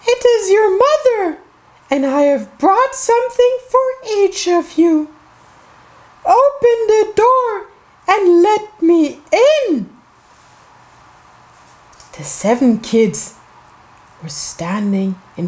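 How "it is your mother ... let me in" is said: very high, put-on sing-song mother voice; wolf posing as mother. A narrator's reading. Wolf at the door to the seven kids, high pretend-mother voice. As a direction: coax in a fake high mother's voice. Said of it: "a voice said".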